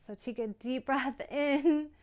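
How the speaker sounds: breaking into a laugh mid-cue. Real, not acted. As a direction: giggle through the breath cue.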